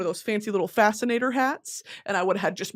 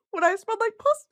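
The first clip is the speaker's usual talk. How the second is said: high squeal; 13 semitones higher, much faster than usual. Pretend.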